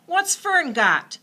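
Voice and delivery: high, loud, slow demand. A narrator's reading. Boy demanding to see the pig, high and loud. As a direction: insist on seeing it.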